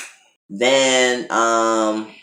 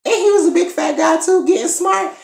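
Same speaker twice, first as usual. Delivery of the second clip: much higher, squeaky excited telling; squeaky scandalized gossip; 3 dB louder than usual. Real, not acted. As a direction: gush in a high squeak. Still reacting to the smart-mouthed guy.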